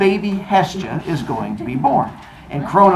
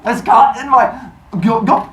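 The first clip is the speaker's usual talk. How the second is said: fake choking; 7 semitones higher, 4 dB louder than usual. Kronos choking on baby.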